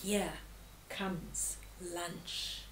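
low, hushed, drawn-out words; hungry leopard thinking aloud, quietly; leopard's sly thought. A narrator's reading. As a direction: think it softly to yourself, low, with pauses. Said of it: "high above leopard thought".

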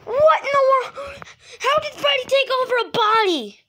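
high-pitched, loud panic; horrified disbelief. Acted. Learning of Freddy's body takeover, horrified and high. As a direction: cry it out in alarm.

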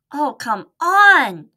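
loud, drawn-out impatient plea. Acted. Impatient mouse.

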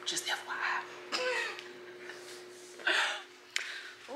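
soft unvoiced FYI; whispered aside. Real, not acted. Vlogger confiding to viewers about her cheap ex, whispered. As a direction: lean in and whisper it.

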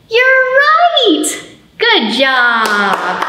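high, loud, delighted "you're right"; excited praise. Acted. Librarian cheering children who guessed elephant, excited. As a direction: cheer the kids' answer with a big high lift.

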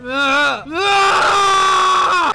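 high, loud chasing shrieks; hammed-up battle screams; mock rage screams. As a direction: scream all out while chasing in a game. Mock rage, attacking friend.

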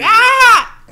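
high, loud sudden shriek. Retold or imitated. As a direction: let out a sharp, high yelp. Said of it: "I'm like".

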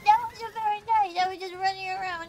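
high-pitched playful scolding, easing at the end; mock offended. Real, not acted. Player to a game enemy that wasn't nice, mock offended.

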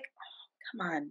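a slow, breathy hush of frustration; hushed, impatient plea; exasperated whisper. Retold or imitated. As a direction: mutter it under your breath, fed up. Exasperated group urging a participant.

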